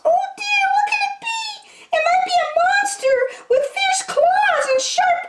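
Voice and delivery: very high, squeaky, frightened; scared mice. A narrator's reading. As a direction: squeal it like terrified little mice.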